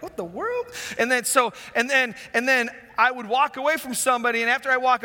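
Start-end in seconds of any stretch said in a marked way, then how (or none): 0.0-0.6 high, soft mock gasp at a bad smell; startled disgust. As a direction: react like someone just caught a whiff. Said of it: "they're like".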